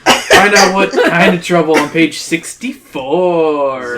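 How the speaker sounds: milk the cliffhanger, stretching it out; teasing cliffhanger. Acted. Narrator sending readers to the next page, teasing cliffhanger.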